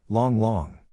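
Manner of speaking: a name called from a little way off; light far-off call. A narrator's reading. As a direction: call out to him from a distance. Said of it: "Suddenly a voice rang out".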